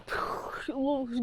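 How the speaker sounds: blown-lip shooting effect; mouthed gunfire noise. Retold or imitated. Reviewer retelling the fight scenes, mouthed gunfire sound. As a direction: imitate shots with a puff through the lips.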